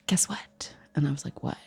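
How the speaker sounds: hushed, unvoiced whisper; whispered secret. Schoolgirl confiding to her friend, whispering. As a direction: share gossip under your breath. Gym class girls sharing a secret.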